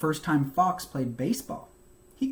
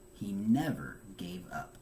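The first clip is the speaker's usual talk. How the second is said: whispered; 5 semitones lower, 5 dB quieter than usual. A narrator's reading. Quiet emphasis in narration.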